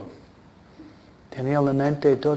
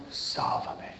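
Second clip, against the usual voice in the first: whispered, breathless cry for help; desperate plea; 13 dB quieter than usual. Retold or imitated. Sinking Peter begging the Lord to save him: frightened whisper. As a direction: gasp it out as if sinking.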